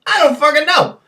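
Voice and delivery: high, loud cry of frustration; frustrated shout; exasperated outburst. Real, not acted. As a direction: throw up your hands and yell it.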